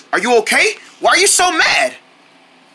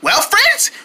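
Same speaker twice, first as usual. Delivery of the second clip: ordinary talk, nothing marked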